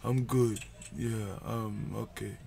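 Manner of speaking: low, soft imitated accent, fading away; Gigs. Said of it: "I'm liking your Gigs accent".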